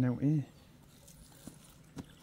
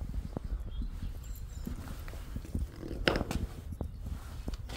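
long, soft unvoiced laughter; breathy laugh; 11 dB quieter than usual. Real, not acted. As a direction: laugh it off under your breath. Laughs after quoting Linda.

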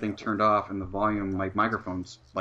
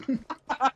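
laughing. Real, not acted. Cracks up after own impression.

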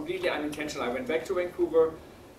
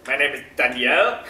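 louder, put-on caller voice; voicing the caller; 10 dB louder than usual. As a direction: announce the name firmly. Caller repeats his name.

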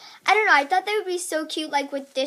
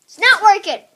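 louder, laughing it off; loud joking protest; 6 semitones higher, 12 dB louder than usual. Real, not acted. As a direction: shout it as a joke. Jokey self-mock, loud.

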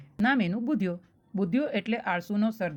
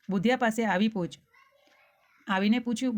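plain reading voice

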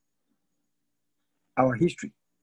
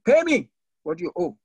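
a sharp, high demand from the creditor; 12 semitones higher, 3 dB louder than usual. A narrator's reading. Creditor throttling his fellow servant, demanding payment. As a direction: seize the debt, high and forceful. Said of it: "took him by the throat, saying".

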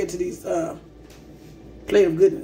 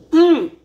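loud savoring mm; 6 semitones higher, 8 dB louder than usual. Real, not acted. Tasting the food.

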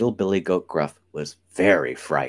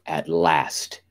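ordinary delivery, nothing marked

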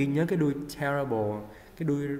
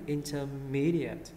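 "intermediate" slowly as inter-MEE-dee-ut; stress on "mí"; much slower than usual. Shown as a demonstration. Teacher models word stress, "intermediate" stressed on MEE. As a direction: hit and stretch "me" in "intermediate". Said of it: "Nhấn nghe âm, âm mí ha".

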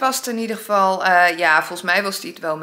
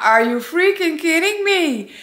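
disbelief; 7 semitones higher, 3 dB louder than usual. Real, not acted. Reacts to McDonald's ban.

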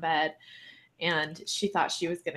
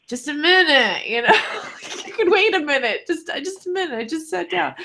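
exasperated plea; 10 semitones higher, 7 dB louder than usual. Retold or imitated. Telling cat to wait.

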